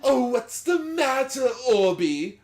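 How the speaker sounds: high, loud taunting Joker voice; mocking villain sneer. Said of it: "Mark Hamill doing Joker".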